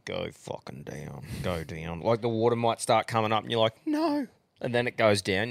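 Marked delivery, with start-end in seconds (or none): 0.0-2.0 low, very soft urging; quietly will it to go, slowly; hushed pleading. Acted. Acting out pleading with toilet. 3.9-4.3 much higher-pitched alarmed no; comic squeak of dread; mock panic. Acting panic at rising toilet water.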